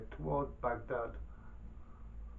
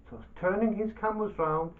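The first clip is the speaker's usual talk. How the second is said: ordinary delivery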